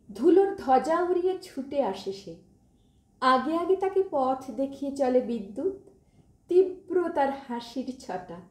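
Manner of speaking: plain reading voice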